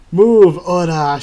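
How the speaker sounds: slow loud order to get out of the way; loud slow shout. Acted. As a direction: bellow it while punching him. Shoving him aside.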